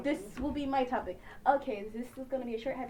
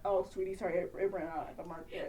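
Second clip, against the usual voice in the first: soft low dad voice; 6 semitones lower, 8 dB quieter than usual. Acted.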